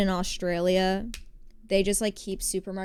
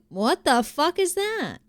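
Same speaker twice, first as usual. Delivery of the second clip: slow, high, incredulous what-the-fuck; retold disbelief; 7 semitones higher, slower than usual. Retelling a friend's Southern store, slow high disbelief. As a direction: stretch it out in shocked disbelief, pitched up.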